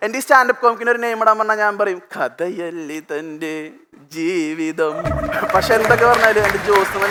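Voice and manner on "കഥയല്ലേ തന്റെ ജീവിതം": very slow, soft dramatic title read. Pretend. Announcing his show's name, slow and soft. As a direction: drag out the title like a film tagline.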